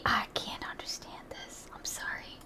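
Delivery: breathless whisper, nearly gone; despairing whisper. Real, not acted. Viewer whispering about the clip, defeated and unvoiced. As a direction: hush it out, defeated. Reacting to a hard-to-follow clip.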